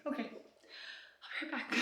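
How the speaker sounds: slow nervous whisper; whispered aside. Real, not acted. Nervous woman whispers to viewers she'll be right back. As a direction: breathe it out slowly under her breath. Nervous, stepping away from camera.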